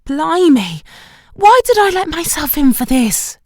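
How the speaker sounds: exasperated Shelley. A narrator's reading. Shelley complaining about Pilates.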